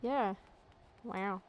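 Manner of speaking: usual speaking voice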